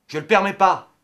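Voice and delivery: loud, high burst of indignation; angry shouted refusal; indignant outburst. Acted.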